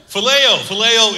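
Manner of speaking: a bold, ringing phileo; proclaimed. Acted. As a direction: announce phileo like a reveal. Announces Greek word loudly.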